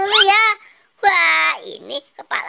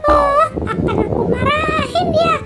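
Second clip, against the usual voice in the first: a much higher, fretful little voice; annoyed high character; 8 semitones higher than usual. Acted. Toy character annoyed his dustpan is gone, high grumbling voice.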